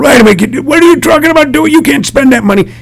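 a touch of a fussy board member protesting; mock indignant objector. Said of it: "my elbow on my knee going".

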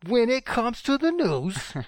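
mock pompous voice. Repeating phrase in mock voice.

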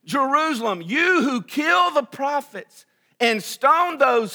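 loud, slow, high lament; Jesus lamenting. Acted. Jesus over Jerusalem, loud slow lament. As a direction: cry out over the city. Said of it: "This is where Jesus says".

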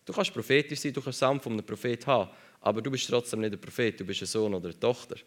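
ordinary talk

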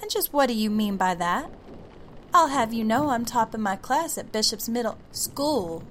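put-on loud, offended young girl; indignant girl. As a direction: huff back in proud protest. Girl bristles back at the voice.

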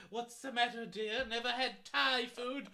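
hello darling as the Queen. Acted. Queen voice continues.

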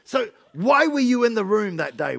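normal talking voice

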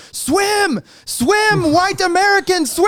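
high-pitched imitated scream across the water; hammed-up frantic calling out; screaming from shore. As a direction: shout it like urging a swimmer on from the beach. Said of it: "starts screaming at me from the shore".

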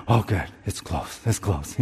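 hushed relief at the closed door; relieved sigh. Retold or imitated. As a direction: let the worry drain out softly.

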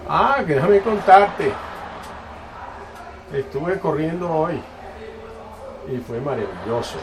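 put-on dad bragging about his run, in a slow, high Indian accent. Dad telling his son about running, in an Indian accent. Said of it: "Lo está usando con el, el acien-, acento indio".

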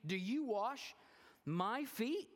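high, loud, disbelieving question; incredulous Peter. Acted. Peter asking Jesus in disbelief, high and loud. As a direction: ask it in shocked disbelief. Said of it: "again, he started".

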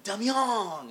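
calling out. Retold or imitated. Ref calls the name out.

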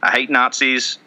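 ordinary talk

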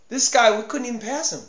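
ordinary talk

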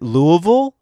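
Louisville with a weak "uh" in the middle; Louisville as LOO-uh-vul. Shown as a demonstration. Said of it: "or is it Louisville or is it Louisville".

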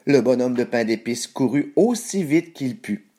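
usual speaking voice

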